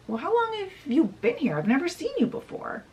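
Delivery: put-on high, fast child's questioning; puzzled little princess. Princess questions her unknown grandmother, high puzzled voice. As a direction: blurt it out high and quick like a curious child.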